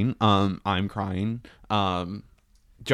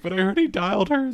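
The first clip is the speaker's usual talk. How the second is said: 1 high, fast protest; 11 semitones higher, much faster than usual. Retold or imitated. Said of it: "I'm like".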